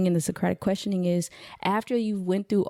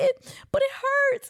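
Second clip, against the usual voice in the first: high, drawn-out mock whimper; slow, squeaky childish whine; imitating crying child; slower than usual. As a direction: play a little boy pleading over a hurt. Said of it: "he's crying".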